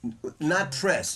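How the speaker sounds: not stretched slow and high; stress on not. Shown as a demonstration. Teacher correcting a student's word, stressing not. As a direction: lean hard on not press, slowly. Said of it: "but not press".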